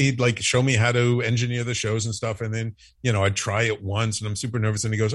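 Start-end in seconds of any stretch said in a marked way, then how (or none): none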